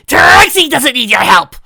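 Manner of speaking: loud, high, slow growling shout; angry retort. A narrator's reading. Trixie rebuffing Spike's advice, loud and angry. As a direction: snap it back at him. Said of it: "replied Trixie with a growl".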